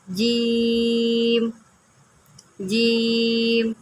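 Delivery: جیم stretched out very slowly; جیم with a long held ee, three alifs long; long drawn vowel. Shown as a demonstration. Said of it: "تین الف کے برابر لمبا کر رہی ہوں".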